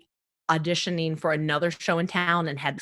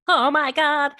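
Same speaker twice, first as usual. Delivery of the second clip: high, loud gasp of panic; panicked; 8 semitones higher, 5 dB louder, slower than usual. Retold or imitated. Panicking over needing a video by tomorrow, high and loud. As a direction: freak out about the deadline.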